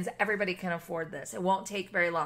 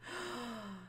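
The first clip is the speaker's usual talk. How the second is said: quiet breathy gasp of wonder; soft gasp; 12 dB quieter than usual. Real, not acted. Spotting a cute detail, soft wondering gasp. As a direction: catch your breath at the archway. Delighted house tour.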